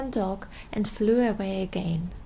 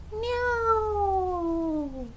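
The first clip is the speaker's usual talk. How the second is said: cat meow; 9 semitones higher than usual. Acted. A cat's noise.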